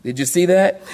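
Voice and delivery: ordinary delivery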